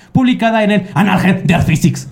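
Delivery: Annalen der Physik in a German accent. Real, not acted. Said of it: "sigo maravillado por tu pronunciación".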